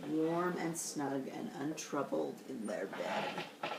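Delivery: put-on reading to kids, softly repeating the cozy line; cozy hush. A narrator's reading. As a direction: lull it like a bedtime line.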